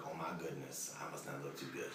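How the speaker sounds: under your breath, dawning dread; hushed aside. Retold or imitated. Shot man thinking to himself on the gurney, whispered. Dying man's private thought.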